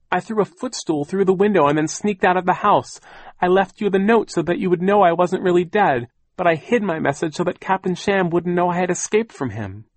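high, loud, defensive aunt voice; cross. A narrator's reading. Aunt defending her escape to the children, cross. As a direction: justify yourself crossly, pitched high. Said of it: "Aunt Josephine said crossly".

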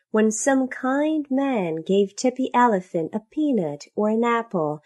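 usual speaking voice

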